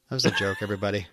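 low-key, muted passive-aggressive aside. Real, not acted. Co-host disclaiming a joke to listeners, quietly passive aggressive. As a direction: drop it softly, as a pointed disclaimer. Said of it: "you can't be passive aggressive".

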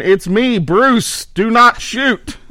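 loud, high shout, slow at the end; hollering. Retold or imitated. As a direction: holler it so nobody shoots. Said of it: "you better be hollering".

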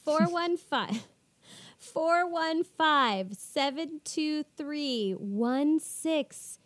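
slow, spaced-out digits; slow number read. Shown as a demonstration. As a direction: read each digit slowly and clearly. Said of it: "phone number read out slowly".

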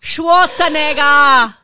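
slow, loud, drawn out Schwarzenegger; Austrian accent. Shown as a demonstration. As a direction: stretch the name, Austrian style. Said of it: "very dangerous word to say".

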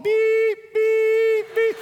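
very high, loud truck-reversing beeps; imitated beeping. Preacher teasing about his pregnant wife walking, high beep noises. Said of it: "She's walking".

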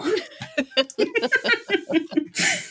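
real laughter. Real, not acted. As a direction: let the laugh trail off. End of embarrassing story.

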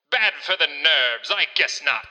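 lightly voiced old-timey barman; a touch of a cocky 1880s bartender. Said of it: "in your old-timiest of voices".

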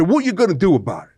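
imitating a tough guy daring you; tough guy taunt. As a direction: toss it off like a Manhattan brawler. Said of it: "he had sort of a tough guy style".

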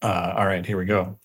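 ordinary delivery

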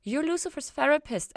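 high, loud sudden realization; high startled realization; dawning realization. A narrator's reading. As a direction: blurt it as it dawns. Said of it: "feeling the words out like they had just occurred to her".

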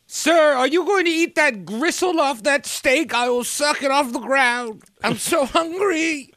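put-on high, pleading starving street kid; begging urchin. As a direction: beg like a hungry orphan girl.

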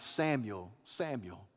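low, soft repeated name; gentle night call. Acted. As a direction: call quietly to a sleeper. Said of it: "the Lord called him at night".

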